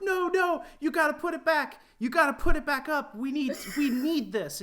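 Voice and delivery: high, loud imitation of desperate fans; mock pleading fans. Fans begging the author to repost his story, mock pleading. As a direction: beg in a hammed-up high voice, urgent then cracking up.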